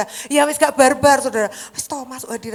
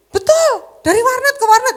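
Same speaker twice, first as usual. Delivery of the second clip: much higher, louder emphasis; high emphatic repeat; 12 semitones higher, 9 dB louder than usual. Real, not acted. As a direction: hammer the point home, pitched up. (menegaskan dengan suara tinggi)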